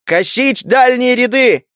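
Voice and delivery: high, drawn-out battle order; roaring command. Acted. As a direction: bellow the order to the slingers. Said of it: "проревел Лука".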